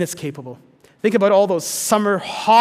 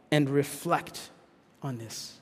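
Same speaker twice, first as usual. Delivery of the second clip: slow and softer, fading almost to nothing; hushed reflective pause; 5 semitones lower, 9 dB quieter, slower than usual. Shown as a demonstration. Preacher invites the congregation to pause, fading softly. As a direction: let the question sink in gently. Reading the Selah pause aloud.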